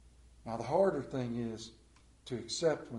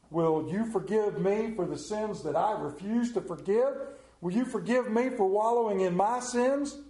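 plain-speech paraphrase; 5 dB louder than usual. Acted. Preacher paraphrasing Donne as a prayer, fervent then hushed. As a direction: pray it from the gut, rising and falling away. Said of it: "in Appalachian American speak".

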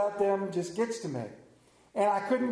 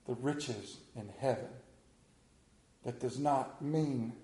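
solemn preaching; 8 semitones lower than usual. Acted. Slow solemn preaching turn.

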